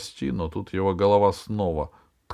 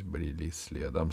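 plain reading voice

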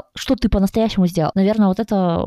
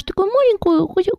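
high belittling; 10 semitones higher than usual. Real, not acted. Belittling tiny result.

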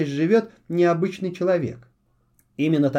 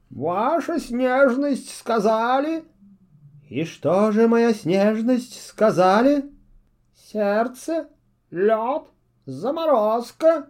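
high, slow fussy owl voice; a bumbling owl servant, eager to please; 5 semitones higher, slower than usual. A narrator's reading. Owl guesses what his witch mistress said, fussy.

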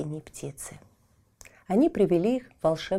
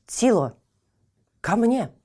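raised, commanding call to a dog; firm boy's command; ordering; 6 semitones higher, 4 dB louder than usual. A narrator's reading. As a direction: call the dog to heel sharply. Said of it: "приказал Тильтиль".

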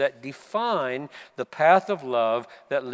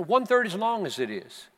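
normal talking voice

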